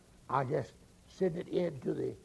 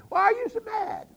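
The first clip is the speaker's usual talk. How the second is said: surprised question; 5 dB louder than usual. Retold or imitated. Asking angry caller why.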